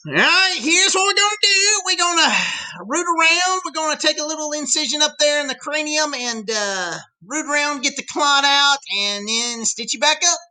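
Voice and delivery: high-pitched hammed-up Southern drawl; Southern drawl doctor. Doctor explaining brain surgery to a patient, exaggerated Southern drawl. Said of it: "because of our long Southern drawl".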